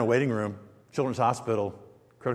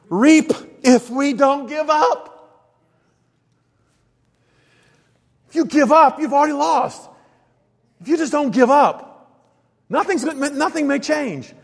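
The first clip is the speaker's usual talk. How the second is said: higher and louder, driving the point home; full-force preaching; 10 semitones higher, 7 dB louder than usual. Acted. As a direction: preach it hard to the congregation.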